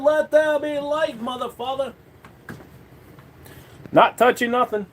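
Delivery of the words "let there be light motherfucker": mock proclamation. Mock biblical proclamation in high voice.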